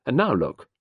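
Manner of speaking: high, loud accusing challenge; heated, pointed reproach; demanding. A narrator's reading. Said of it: "Sarah demanded".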